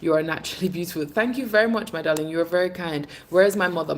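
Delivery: usual speaking voice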